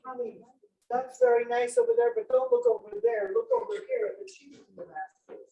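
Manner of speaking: high-pitched mock mother; mom's voice. Mom to kids at the store, mock mom voice. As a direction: imitate a mom steering the kids away, high up. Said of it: "Mom said".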